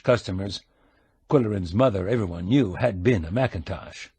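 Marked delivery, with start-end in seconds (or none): none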